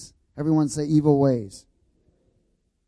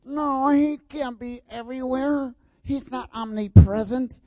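lightly voiced skeptic, higher at first; doubting people; 8 semitones higher than usual. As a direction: mimic a doubter's protest, half-mocking. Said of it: "Now people think".

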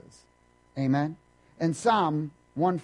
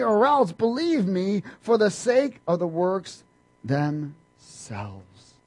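preaching Jesus' words; 4 semitones higher, 6 dB louder than usual. Acted. Preacher voicing Jesus rebuking Philip, loud and ringing. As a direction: preach it with full force.

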